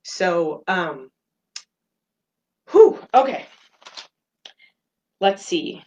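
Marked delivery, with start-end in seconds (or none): 2.7-3.5 a sharp, loud "whew" to steady herself; emotional exhale. Real, not acted. Host steadying herself after recalling her late father, shaky whew. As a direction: blow out the tears and reset. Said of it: "I got a little flustered and feeling a little bit em-, emotional".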